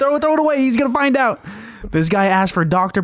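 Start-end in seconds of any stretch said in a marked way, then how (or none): 0.0-1.5 mock panic. Joins the mock cover-up.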